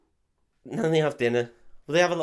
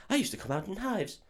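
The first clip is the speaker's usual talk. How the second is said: put-on high, soft posh English girl; mock-posh English accent, pitched up; 8 semitones higher, 8 dB quieter than usual. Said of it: "she sounds very posh".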